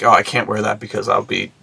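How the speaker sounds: normal talking voice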